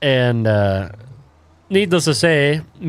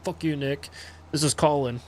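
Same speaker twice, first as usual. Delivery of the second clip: quiet retelling; 3 semitones higher, 8 dB quieter than usual. Retold or imitated. Retelling the classmate's text to Nick, softly. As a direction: toss it off under the breath. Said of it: "he was like".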